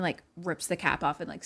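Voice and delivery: usual speaking voice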